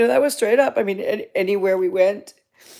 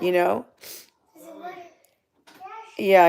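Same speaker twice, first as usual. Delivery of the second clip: ordinary talk, nothing marked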